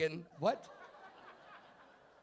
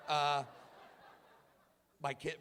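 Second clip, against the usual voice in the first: ordinary talk, nothing marked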